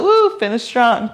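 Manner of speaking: sudden high woo, pushing on; cheering. Real, not acted. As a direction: cheer them through. Coach cheering final reps.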